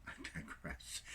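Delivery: I digress breathed out through a soft laugh; laughing whisper. Real, not acted. Catching himself rambling, a soft amused whisper. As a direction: whisper it while chuckling at yourself.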